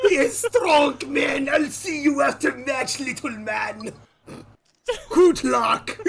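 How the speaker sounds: lightly voiced gruff tough guy; muscly voice. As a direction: puff up like a bodybuilder. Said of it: "really muscly voice there".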